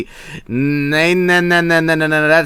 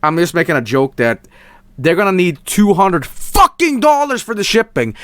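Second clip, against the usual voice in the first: normal talking voice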